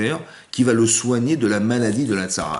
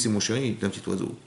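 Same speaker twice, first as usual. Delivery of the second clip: soft mock tenderness; 2 semitones lower, 7 dB quieter than usual. Teacher coaxing a proud student.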